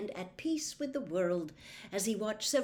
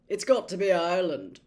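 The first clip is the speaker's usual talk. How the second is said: louder, thick voice through a mouthful; mouthful of potato; 10 dB louder than usual. A narrator's reading. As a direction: talk round a mouthful of potato. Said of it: "thickly through a mouthful of potato".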